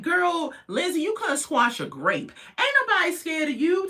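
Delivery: high, sassy brush-off; mocking retort. Talking back to Lindsay on the show, mocking and high.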